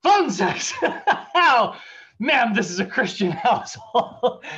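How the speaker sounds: very high, loud fake indignation with laughs; mock offended dad. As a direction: clutch your pearls, laughing. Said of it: "He's like".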